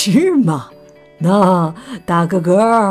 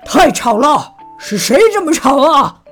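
grumpy roar; 4 semitones higher, 4 dB louder than usual. Acted. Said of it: "熊爸爸突然吼了起来".

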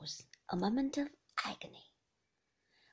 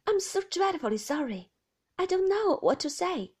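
higher, louder, flustered apology; an embarrassed sister-in-law apologising; apologetic Beatrice; 9 semitones higher, 9 dB louder than usual. Acted.

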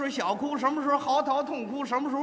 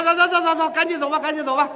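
impatient policeman; 3 semitones higher, 6 dB louder, faster than usual. Retold or imitated. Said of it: "警察说".

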